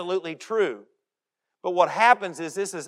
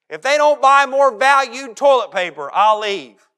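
huffy ultimatum; 7 semitones higher, 11 dB louder than usual. Retold or imitated. Preacher mocking members threatening to leave, loud and high. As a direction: whine it like a spoiled member.

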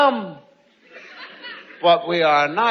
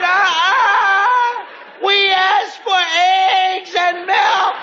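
put-on whiny kids tattling; 11 semitones higher, much slower than usual. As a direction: whine it out like a tattling kid. Children tattling on dad.

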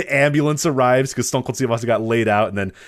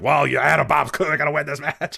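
imitating Vince McMahon; 6 semitones higher than usual. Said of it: "Vince is like".

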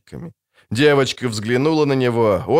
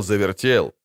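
plain reading voice

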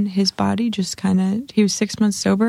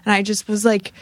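normal talking voice

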